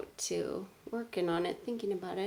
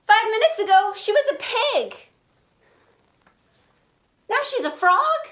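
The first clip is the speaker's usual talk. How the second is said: much higher, loud astonished musing; Gerald in disbelief; 12 semitones higher, 13 dB louder than usual. Acted. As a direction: work through the shock out loud. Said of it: "When I say the Gerald part".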